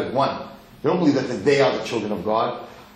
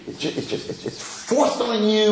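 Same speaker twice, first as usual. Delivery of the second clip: higher, drawn-out mock complaint; mock resentment; 7 semitones higher than usual. Teacher voicing a man who feels forced, mock resentment. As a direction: imitate someone fed up with religion. Mimics a resentful religious worker.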